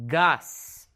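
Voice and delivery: Gas as GAHS, open a; Spanish "Gas" with ah; Spanish pronunciation. Shown as a demonstration. Teacher models the vowel, Spanish Gas. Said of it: "Not gas".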